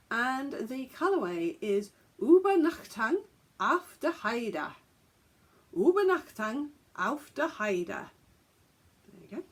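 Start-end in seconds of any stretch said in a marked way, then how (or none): 2.2-4.7 deliberate English-speaker German; attempted German pronunciation. Shown as a demonstration. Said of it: "attempt the pronunciation of the colourway names". 5.7-8.1 deliberate English-speaker German; attempted German pronunciation. Shown as a demonstration. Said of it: "attempt the pronunciation of the colourway names".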